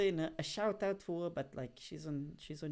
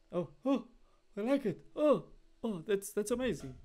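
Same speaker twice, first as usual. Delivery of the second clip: higher, louder mock delight; mock gushing; 5 semitones higher, 5 dB louder than usual. Streamer imitates himself blindly liking random stuff, mock gushing. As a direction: sniff and exclaim as if wowed, hammed up.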